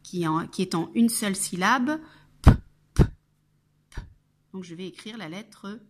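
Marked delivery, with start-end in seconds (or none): 2.4-4.0 "p" as a bare puff of the lips; isolated phoneme. Shown as a demonstration. Teacher making the first phoneme heard, "p" sounded alone. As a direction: pop "p" three times without a vowel. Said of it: "qui fait le phonème".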